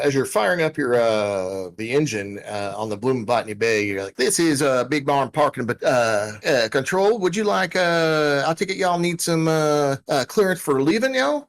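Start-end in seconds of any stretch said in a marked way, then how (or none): 4.2-11.5 high-pitched, folksy parking attendant; folksy parking controller. Acted. Parking control to departing ship, folksy and high-pitched.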